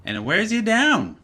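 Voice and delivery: usual speaking voice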